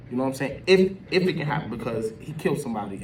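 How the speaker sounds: normal talking voice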